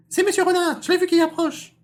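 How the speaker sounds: piercing high, loud cry; distraught baby rabbit. Acted. Little rabbit calling his mother, frantic high cry. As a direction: shriek for mother in a tiny squeaky voice. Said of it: "se précipita tout bouleversé".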